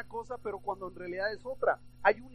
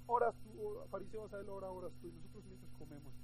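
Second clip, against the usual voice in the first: hushed, acted scheming aside; mock conspiring; 10 dB quieter, faster than usual. Pharisees sharing out prayers while eating, hushed mock conspiring. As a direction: share out tasks under your breath. Pharisees secretly share out praying.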